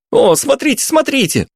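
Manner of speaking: put-on high, excited teen shouts; excited shouting. A narrator's reading. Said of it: "наперебой закричали подростки".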